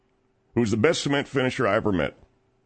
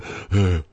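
heavy phone breathing imitation. Host scolds Dorton for breathing on the phone, imitated heavy breathing. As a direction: mimic a wordless breather. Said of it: "when you breathe on someone like that".

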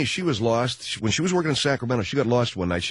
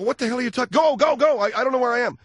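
wife's voice, panicky; 4 dB louder, faster than usual. Retold or imitated. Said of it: "I go".

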